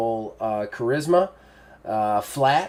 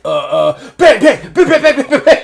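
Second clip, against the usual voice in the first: very high, loud squealed gunfire noises; hammed-up panicky shriek; mock panic; 15 dB louder than usual. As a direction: squeal pretend gunshots in a comic panic. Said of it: "waiting for you to be like".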